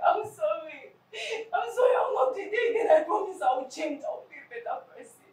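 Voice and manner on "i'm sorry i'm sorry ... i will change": crying, begging. Acted. Guilty friend begging forgiveness through tears. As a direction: wail the apology.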